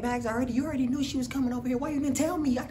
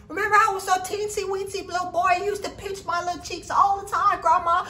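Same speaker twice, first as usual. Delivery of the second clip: put-on high, chirpy little-boy voice; little boy Zach; 4 semitones higher than usual. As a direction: play an eager seven-year-old. Zach voice again.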